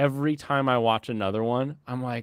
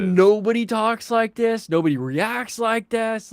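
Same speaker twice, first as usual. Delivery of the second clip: exasperated rant; 9 semitones higher, 5 dB louder than usual. Real, not acted. Ranting about anime dialogue.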